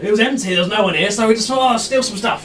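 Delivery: Garrett the captain. Acted. Charmed captain introducing himself to the pirates. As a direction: announce your name cheerfully. GM voicing captain explaining looting.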